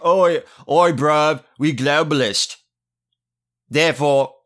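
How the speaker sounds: hammed-up British "oi bruv" accent; mock British lad accent. Host mocking independence supporters in a British lad accent. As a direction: play a dim British lad.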